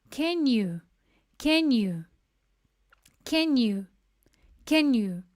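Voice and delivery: "Can you" run together as ken-u; "Can you" blended into ken-u, the teacher's model; contracted can you. Shown as a demonstration. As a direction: say "Can you" as one quick ken-u, the y nearly gone. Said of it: "vai ter essa contração aqui de pronúncia".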